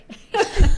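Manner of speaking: loud high laugh at her own joke; burst of laughter. Real, not acted. Laughing at her bad sense of direction, a loud high laugh.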